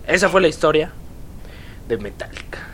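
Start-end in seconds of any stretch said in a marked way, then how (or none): 1.9-2.6 soft dramatic whisper; whispered ending. Acted. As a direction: breathe the last words out. Dramatic close of story.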